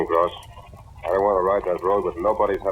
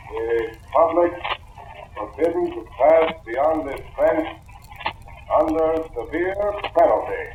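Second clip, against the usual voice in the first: high, slow, emphatic sign reading; slow, pointed recital of a posted warning; reading a sign aloud; 9 semitones higher, slower than usual. Acted. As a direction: read the warning out with weight on each word. Reads the sign again, on request.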